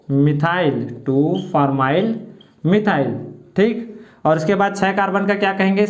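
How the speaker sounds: usual speaking voice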